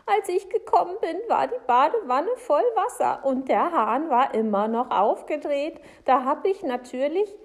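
high, loud, upset telling; worked-up woman recounting a shock; agitated Frau Segebrecht. Acted. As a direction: pour out the complaint, pitched high.

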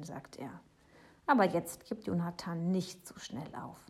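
usual speaking voice

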